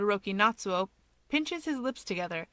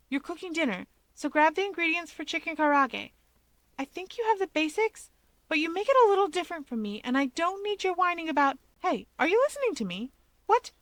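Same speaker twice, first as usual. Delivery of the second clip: higher, brisk sisterly scolding, trailing to a sheepish "Ah"; exasperated older sister; 8 semitones higher than usual. A narrator's reading. Older sister scolding brother about one job, higher and brisk. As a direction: nag like a big sister who's had enough.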